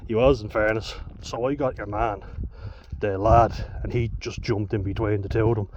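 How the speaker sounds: usual speaking voice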